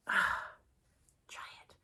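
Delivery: a sigh, then a breathy whispered try it; whispered urging. Real, not acted. Urging listeners after a sigh.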